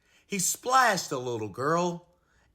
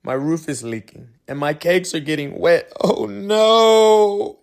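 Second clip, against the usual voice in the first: moaning; 7 dB louder than usual. Acted. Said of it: "he moaned".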